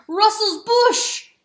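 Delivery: slow, high, loud roar stressing bush. Acted. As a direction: roar it at the weasel while running. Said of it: "he roared to the weasel".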